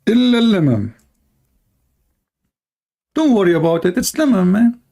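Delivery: high, sarcastic "don't worry" voice; mocking reassurance. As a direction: mimic a casual believer waving it off.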